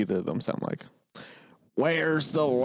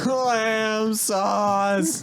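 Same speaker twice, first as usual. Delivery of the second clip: mock shouted catchphrase; 10 semitones higher, 4 dB louder, much slower than usual. Shouting Ramsay catchphrase repeatedly.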